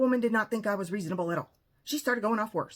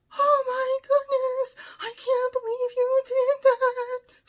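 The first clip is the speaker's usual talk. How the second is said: very high, slow, wailing imitation; hammed-up squealing horror, drawn out; mock freak-out; 5 dB louder, slower than usual. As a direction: imitate a therapist melting down in falsetto. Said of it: "Freaking out".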